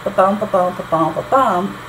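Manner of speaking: four linked ba-BUMs; iambic tetrameter beat. Shown as a demonstration. Teacher proving the third line still fits, iambic beat. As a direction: beat out ba-bum, landing on BUM. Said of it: "iambic tetrameter".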